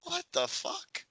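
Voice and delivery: high, slow, drawn-out disbelief; stunned high-pitched outrage; incredulous. Real, not acted. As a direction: squeak it out in total disbelief. Outraged at plot.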